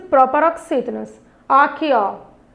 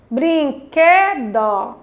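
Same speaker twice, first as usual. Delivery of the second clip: BRINQUEDO stretched very slowly, syllable by syllable, QUE stressed; syllable stress demo; much slower than usual. Shown as a demonstration. Teacher has children find the tonic syllable, Brinquedo slowly stressed on QUE. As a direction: draw out each syllable of Brinquedo, leaning on QUE. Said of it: "foi a sílaba tônica".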